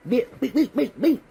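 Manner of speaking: high-pitched beep beep, like a censor tone; playful sound effect. Retold or imitated. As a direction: chirp out the beeps. Imitated sound of fight.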